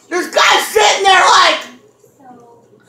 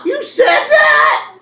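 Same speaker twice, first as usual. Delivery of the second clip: higher, slow, incredulous you said that; surprised disbelief; 9 semitones higher than usual. Retold or imitated. The friend acting shocked at the warning, a slow, high incredulous question. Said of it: "she's all".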